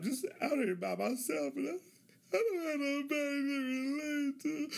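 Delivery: voicing his loneliness with a catch of crying; sad lament. Mock-tearful line about being alone in Lubbock. Lonely young man far from family.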